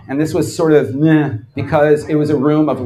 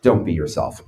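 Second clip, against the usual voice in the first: much lower, quiet deadpan delivery; dry low callback; deadpan aside; 11 semitones lower, 4 dB quieter than usual. Real, not acted. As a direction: throw it away dry and low. Repeated deadpan punchline.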